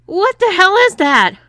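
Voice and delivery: high, loud startled cry; a husband jolted by a shock cries out; shocked yell. A narrator's reading. Shocked husband after electric blast.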